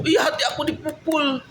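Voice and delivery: high-pitched imitation of the wife, protesting; mimicking wife. Husband mimics wife accusing him before her father, high-pitched. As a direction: raise the voice high like his wife refusing. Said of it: "retold wife's reply".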